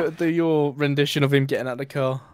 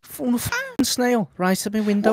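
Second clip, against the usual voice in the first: higher indignant Scouse moan; 7 semitones higher than usual. Acted. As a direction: complain in Scouse, outraged. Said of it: "Go on, [name]".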